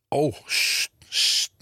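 a boy's put-on bossy hushing; mock commanding hush. A narrator's reading. Cheeky boy hushing the classroom like a teacher. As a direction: play the strict one, hushing the class. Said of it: "commandeerde Bram Hezing".

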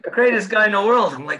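normal talking voice

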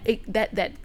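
normal talking voice

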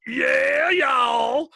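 high, loud drawn-out holler; big stretched-out country whoop; Melvin's voice. Acted. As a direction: holler it long and high, like calling across a field. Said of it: "I first fell into that voice for Melvin".

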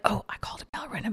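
unvoiced hushed self-quote; whisper. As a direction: whisper it like a private thought. Retold own thought, whispered.

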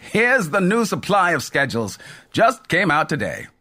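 a light laugh as he hands over schedules; laughing conductor. A narrator's reading. Conductor chuckling while showing new schedules. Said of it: "Mel laughed".

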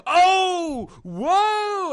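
high startled oh whoa; surprised gasp. Retold or imitated. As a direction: gasp in mock amazement. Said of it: "he's just like".

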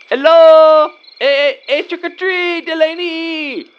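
high, drawn-out trick-or-treat holler; calling at the door. Acted. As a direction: sing it out to someone inside the house. Shouting at her door for candy.

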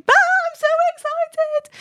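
very high squealing over-excited yelp, stretched out; high-pitched puppyish squeal of delight; demented spaniel puppy. Retold or imitated. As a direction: squeal like a yappy puppy thrilled to see a friend. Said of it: "demented spaniel puppy".

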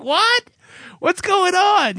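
very high, drawn-out mock alarm; shocked disbelief. Patient reacting to the tech's instruction, shocked disbelief. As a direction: squeal it in comic bewilderment. His retold shock during the exam.

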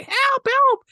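high-pitched mock damsel's cry; cry for help. Lois hanging by herself, mock frightened cry. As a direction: squeal for help in a put-on high voice. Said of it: "she's hanging by herself".

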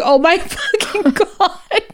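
high, slow groan broken by laughter; laughing mortification. Retold or imitated. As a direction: crack up while reliving your embarrassment. Retelling own mortification while laughing.

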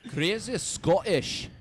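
slow, high Scottish accent. Shown as a demonstration. Asked to show the accent again, Scottish. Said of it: "You should do it again".

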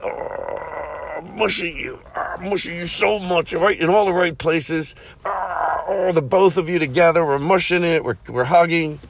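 hammed-up cuddly straining; squeezing hug. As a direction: say it as if squeezing someone tightly. Pretends to squeeze fans through phone.

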